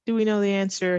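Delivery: ordinary delivery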